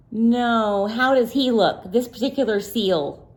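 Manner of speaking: usual speaking voice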